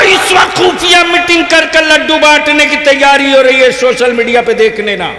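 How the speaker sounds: full-force preaching, high and loud; shouted sermon. Acted. Preacher shouting warnings to the faithful. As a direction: roar it at the crowd like a rally speaker. Fiery rally speech.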